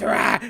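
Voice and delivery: short, loud mock roar; imitated monster roar. Imitating the scary balloon clown, loud mock roar. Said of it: "like, when it goes".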